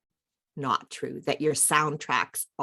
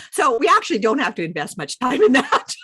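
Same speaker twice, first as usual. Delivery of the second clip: laughing through the words, higher and louder; 8 semitones higher, 8 dB louder than usual. Real, not acted. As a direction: chuckle it out mid-sentence. Leader laughs at own joke.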